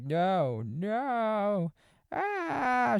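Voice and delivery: rising into a high mock shriek; hammed-up terror building to a yelp; mock freak-out. Rose freaking out in front of witnesses, high mock shriek. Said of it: "she's like".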